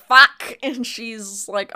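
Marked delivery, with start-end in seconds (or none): 0.0-0.6 loud, sharp "Fuck!" shout; hammed-up pained curse; mock yelp of pain. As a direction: yelp it like you just got stabbed.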